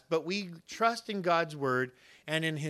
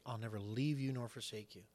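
ordinary talk, nothing marked

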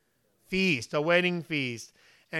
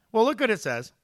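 ordinary talk, nothing marked

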